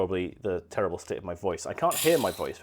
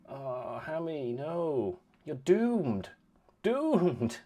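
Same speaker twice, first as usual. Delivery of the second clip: mock doom; 6 semitones higher, much slower than usual.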